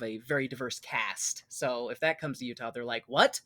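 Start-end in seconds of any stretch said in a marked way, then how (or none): none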